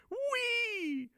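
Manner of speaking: shrill "whee" of glee; high squeal of joy. Real, not acted. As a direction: squeal with delight. Delighted squeal.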